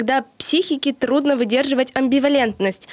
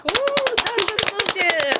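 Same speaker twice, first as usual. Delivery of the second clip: excited cheer; 9 semitones higher, much slower than usual. Real, not acted. Host cheers guest's joke intro.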